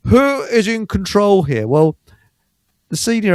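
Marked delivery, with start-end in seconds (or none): 0.1-1.7 stern policeman. Retold or imitated. Copper demanding, retold slowly.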